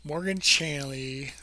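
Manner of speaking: slow, fumbling words through numb lips. Real, not acted. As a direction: lips won't cooperate, slow and clumsy. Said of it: "my lips don't work".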